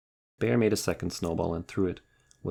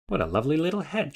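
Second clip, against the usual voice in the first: high, fast call across the hill; shouted from afar; 10 semitones higher, 3 dB louder, faster than usual. Acted. Bear calling down from the hilltop, high and quick. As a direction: call it out cheerfully from far away. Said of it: "shouted Bear from the hilltop".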